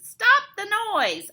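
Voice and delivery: high, loud exasperated screech; high, fed-up bat voice. A narrator's reading. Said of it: "he screeches".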